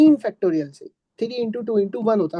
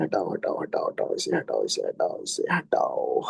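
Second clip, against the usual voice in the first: whispered aside; 6 dB quieter than usual. Real, not acted. Teacher muttering while clearing board.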